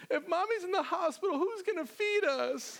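hammed-up high sobs, then a long wail; sob, then wail it very high like a little kid; imitated child wailing. Daughter frets about dinner, exaggerated imitated crying. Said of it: "she just starts sobbing".

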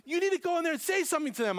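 high, fast, insistent retold order; insistent friend. Friend orders preacher back into the gathering, mock insistent. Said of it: "He goes".

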